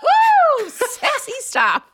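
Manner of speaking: high, rising whoops of glee; excited woo. Real, not acted. Teasing about sexy fan fiction.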